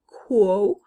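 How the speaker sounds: QUO as a loud, clear "kwoh"; isolated syllable. Shown as a demonstration. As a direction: sound out QUO alone. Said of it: "It's beginning the word".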